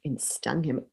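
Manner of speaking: ordinary delivery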